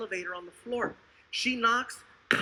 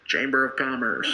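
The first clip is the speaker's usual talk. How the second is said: very low, formal voice; fake official caller; 11 semitones lower, 3 dB louder than usual. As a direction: put on a deep businesslike announcement. Fake visitor answering at the door.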